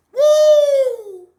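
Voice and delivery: sharp high loud woo; high whoop. Real, not acted. Speaker whooping at his own point, loud. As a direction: let out a high yelp. Exclamation after shout.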